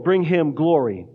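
usual speaking voice

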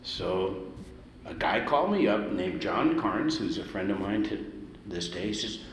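ordinary talk

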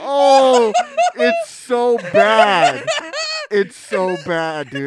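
breathless giggles through disgust; laughing in disgust. Real, not acted. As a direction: laugh helplessly while gagging. Reacting to a fart, laughing.

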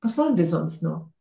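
ordinary talk

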